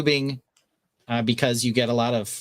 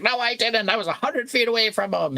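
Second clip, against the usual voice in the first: higher mock protest; brother-in-law retort; 10 semitones higher than usual. Brother-in-law denying the loon charge, higher mock voice. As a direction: squeak a defensive denial. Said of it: "He's like".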